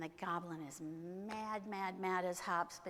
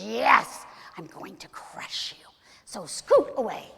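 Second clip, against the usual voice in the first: loud, forceful storybook threat; menacing threat; 4 semitones higher, 17 dB louder, slower than usual. Acted. Man threatens to crush little goblin, loud and menacing. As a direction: bear down hard, then shoo them off.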